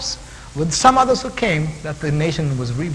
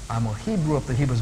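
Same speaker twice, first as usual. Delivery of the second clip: normal talking voice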